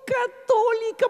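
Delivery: high, drawn-out tearful begging; wailed plea. Retold or imitated. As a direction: wail it out slowly, begging for rescue. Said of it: "Empecé a gritar".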